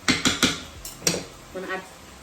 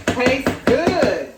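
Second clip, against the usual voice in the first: higher and louder, savouring each word; delighted; 6 semitones higher, 8 dB louder than usual. Real, not acted. Tasting the sauce, loud delighted praise. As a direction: relish the taste out loud.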